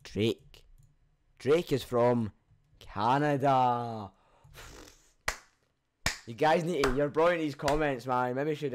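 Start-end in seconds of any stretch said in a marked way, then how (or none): 1.4-4.1 slow emphatic correction. Shown as a demonstration. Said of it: "American accent, [name]".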